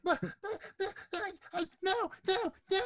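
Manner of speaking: high, panicked stammer; freaking out. Acted. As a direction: stutter in terror as he flees. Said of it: "he freaks out".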